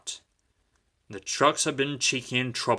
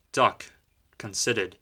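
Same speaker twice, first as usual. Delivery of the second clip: ordinary delivery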